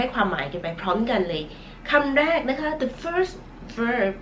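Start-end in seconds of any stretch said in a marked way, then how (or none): 1.9-4.1 slow announcing. Acted. Slow presentation of first verb.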